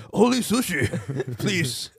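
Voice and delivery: laughing mock Japanese accent; lightly put-on Japanese accent, giggling. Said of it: "why the guy has a Japanese accent".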